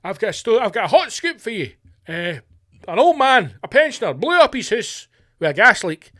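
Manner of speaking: high, loud, excited hack pitching a scoop; eager journalist. Retold or imitated. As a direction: sell the hot scoop like a breathless tabloid reporter. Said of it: "somebody's going".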